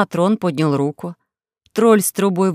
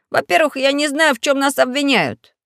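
put-on higher, boyish defiant objection; brave boy; 7 semitones higher than usual. Pashka challenging the court, higher boyish voice. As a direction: talk back like a plucky kid.

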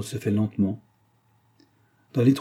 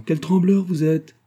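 high, louder, drawn-out teasing; merry teasing; 8 semitones higher, 5 dB louder than usual. A narrator's reading. Antonine laughing off nurse's worries, merry teasing. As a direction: tease brightly, no actual laugh. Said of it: "dit Antonine en riant".

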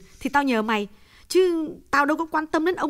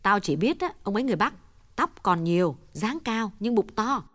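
mild snap; 10 semitones higher, 5 dB quieter, faster than usual. A narrator's reading. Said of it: "Hồng gắt nhẹ".

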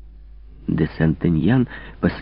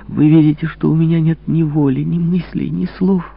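high, louder, rising plea; desperate plea; 9 semitones higher, 3 dB louder than usual. Acted. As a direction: cry out to be understood. Louise pleads with the king.

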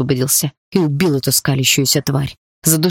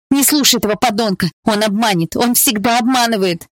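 higher, urgent cry across the room; yelled warning; 7 semitones higher than usual. Acted. Bound girl shouting to her beloved: high, urgent cry. Polina yells warning to Dima.

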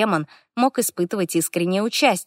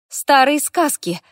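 high, slow exclamation; exclaiming; 8 semitones higher, 4 dB louder, slower than usual. A narrator's reading. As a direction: cry it out in disbelief. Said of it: "воскликнула я".

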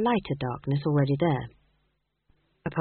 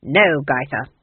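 put-on louder, curt old witch; stern refusal; 3 semitones lower, 5 dB louder, slower than usual. A narrator's reading. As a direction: shut it down sharply. Granny refuses.

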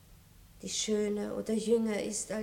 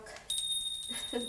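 kurzes Lachen; 3 dB quieter than usual. Acted. Kurzes Auflachen zum Diener.

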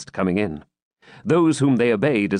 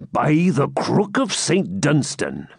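slow, hearty friar's oath; jovial hermit voice; 4 semitones higher, slower than usual. A narrator's reading. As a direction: swear it like a jolly friar. Chaplain hermit speaks.

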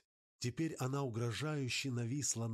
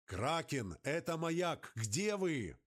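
calling into radio; 6 semitones higher than usual. Acted. Calling over the radio in a storm.

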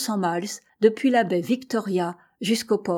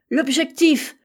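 louder, higher cry of sudden realisation; exclaimed cry; 7 semitones higher, 5 dB louder, slower than usual. A narrator's reading. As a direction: cry it out as if just discovering it. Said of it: "s'écria-t-il".

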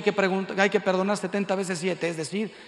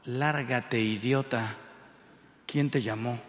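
injured man; 7 semitones lower, slower than usual. Retold or imitated. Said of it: "Un tío con toda la cara rota".

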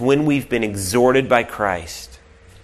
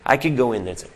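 ordinary delivery

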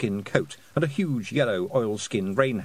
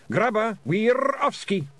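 high, loud Scots with rolled r; shouted order; 11 semitones higher, 5 dB louder than usual. A narrator's reading. Pixie leader ordering the getaway, shouting. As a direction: shout it as you bolt. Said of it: "he shouted".

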